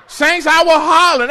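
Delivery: loud, laughing confession. Real, not acted. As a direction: own it out loud through a laugh. Repeats confession, second louder.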